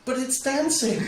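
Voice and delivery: mock-innocent defence; playful protest. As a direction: plead like it settles everything. Defending silly magic trick.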